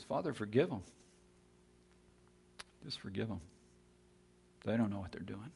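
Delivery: hushed, imitated dying prayer; quiet plea for forgiveness. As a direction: plead gently, trailing into near-silence. Voicing Jesus praying from the cross.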